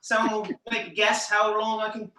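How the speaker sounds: high, put-on English accent for a stage character; bad English accent. Magician in character introducing his escape, mock English accent. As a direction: announce the stunt in a hammed-up English accent. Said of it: "I was talking to him like this".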